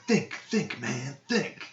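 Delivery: lightly voiced Wahlberg thinking hard; lightly voiced cop urging himself. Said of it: "he's like".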